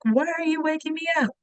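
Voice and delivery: high, whiny retold snap at mom; mock angry yell. Woken daughter snapping at mom, high and whiny. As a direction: play the grumpy kid woken too early. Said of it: "yelling and screaming at her".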